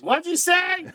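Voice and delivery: high, puzzled squeak; mock confused woman. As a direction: play a confused old lady. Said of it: "if the woman goes".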